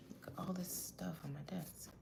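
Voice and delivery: soft whisper to herself; whispered aside. Real, not acted. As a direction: murmur it under your breath. Aside about her messy desk.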